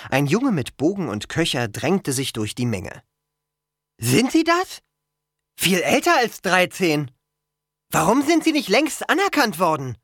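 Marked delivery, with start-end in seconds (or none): 4.0-10.0 put-on high, louder puzzled boy's voice; insistent questioning boy. A narrator's reading. Boy questions much older newcomers, insistent and high. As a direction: press the newcomers in a raised pitch.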